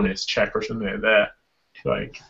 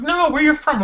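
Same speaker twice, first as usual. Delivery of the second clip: mock pushy questioner.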